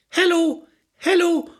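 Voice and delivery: high, repeated door call; acted chambermaid's high call; insistent calling maid. A narrator's reading. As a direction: keep calling through the door as room service. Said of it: "hämmerte es unaufhörlich an der Tür".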